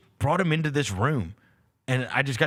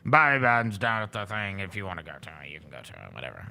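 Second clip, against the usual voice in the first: mock-pissy boss, trailing off quieter and quieter; 9 semitones lower, 3 dB quieter, faster than usual. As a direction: toss it off like a bored, irritated boss who doesn't care. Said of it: "pissy about everything".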